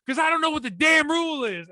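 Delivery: high, loud angry outburst from a furious coach; retold angry yell. Coach explaining his anger to a player, furious yell. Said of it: "he got mad. I mean, he's, like, throwing stuff angry".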